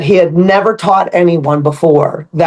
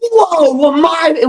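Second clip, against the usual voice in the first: surprised whoa; 9 semitones higher, much slower than usual. Retold or imitated. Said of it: "I'm like".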